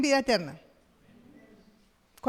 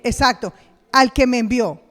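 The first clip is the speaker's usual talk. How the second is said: loud slow emphatic preaching; full-force preaching; 9 dB louder than usual. Acted. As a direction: drive the words home.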